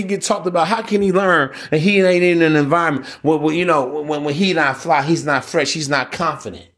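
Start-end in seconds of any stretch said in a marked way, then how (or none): none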